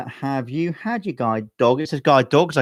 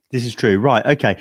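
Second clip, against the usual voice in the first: ordinary talk, nothing marked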